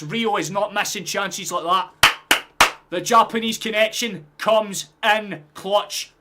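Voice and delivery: loud, high-pitched raving after a goal; elated commentary. Real, not acted. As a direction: hail the goal at full volume, savouring key words. Raving about the goal just scored.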